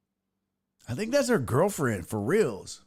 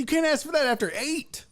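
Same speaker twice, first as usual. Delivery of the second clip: higher, teasing agreement; playful quip; 11 semitones higher than usual. Real, not acted. Host joking back at a viewer's comment, higher and teasing. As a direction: tease back, pitched up and playful.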